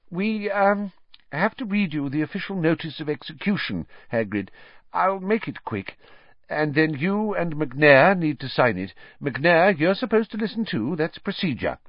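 high, quick, fussy official voice; a flustered minister hurrying through it; nervous official. A narrator's reading. Fudge reading to Hagrid.

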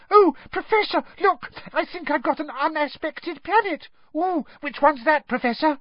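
very high, gushing squeal; a thrilled girl squealing to her teacher; excited squeals. A narrator's reading. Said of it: "excited squeals from Lavender".

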